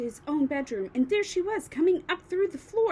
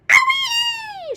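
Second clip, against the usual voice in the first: very high, loud crone's scream; 12 dB louder than usual. A narrator's reading. Grandma after the second dose, screaming. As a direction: let out a wild whoop. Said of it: "she screamed".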